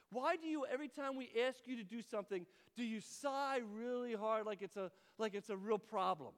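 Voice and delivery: loud, high-pitched retold complaint; blunt complaint. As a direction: confront them loudly about a bad habit. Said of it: "always says what's on her mind".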